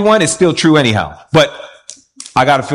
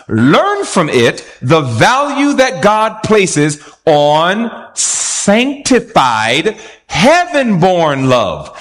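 measured, reverent reading of scripture; slow solemn quote reading; 4 semitones higher, slower than usual. Acted. Preacher reading quote slowly to congregation. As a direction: read it slow and weighty, savoring each phrase.

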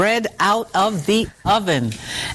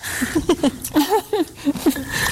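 delighted laugh; 8 semitones higher than usual. Real, not acted. Laughing while tasting.

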